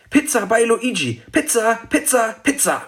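put-on high, stubborn child's chant; trotzig. A narrator's reading. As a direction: insist on it in a high little-girl voice. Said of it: "sagte Mathilda trotzig". (hoch und trotzig wiederholt)

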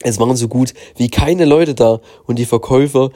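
usual speaking voice

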